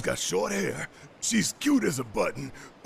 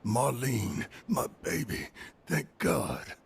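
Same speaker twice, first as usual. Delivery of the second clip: low, softened voice full of relief; hushed, deep fatherly tenderness; tender relief; 8 semitones lower, 5 dB quieter, slower than usual. Acted. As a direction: murmur it gratefully over your sleeping child. Father finds his sleeping daughter safe.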